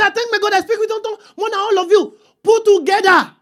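high, sustained preacher's proclamation; proclaimed boast. Acted. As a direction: announce it high to a whole congregation. Repeats boast as to whole city.

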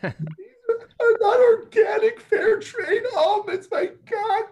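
hammed-up tearful high-pitched whine; mock crying. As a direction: wail like the almonds ruined everything. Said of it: "She's like".

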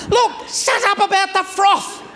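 very high, strained mock yell; slow, screeched-out mock fury; exasperated outburst. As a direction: explode in comic exasperation.

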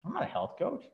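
soft, smug brush-off of the idea; smug dismissal. Retold or imitated. Retelling his old ego to the group, smug. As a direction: say it like it's beneath you. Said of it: "did you see the expression on my face".

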